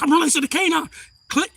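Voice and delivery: high, loud retold police call; imitating an officer's yell; shouted cop warning. Acting cop's shouted warning.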